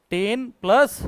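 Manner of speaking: usual speaking voice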